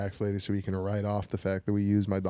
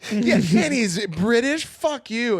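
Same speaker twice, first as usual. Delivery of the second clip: mock outrage; 11 semitones higher, 5 dB louder, much slower than usual. Mock outrage about British rival.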